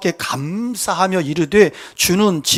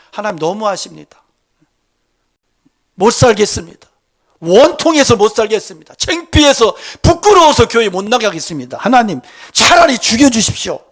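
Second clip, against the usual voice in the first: acted desperate protest; 3 semitones higher, 6 dB louder than usual. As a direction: charge at God with mock-desperate reproach. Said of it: "막 이러면서 달려들어요". (하나님께 대들듯 점점 격해지는 원망 연기)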